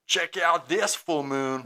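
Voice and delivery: cheeky ghost voice. Pretend. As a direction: tease them like a mooning ghost.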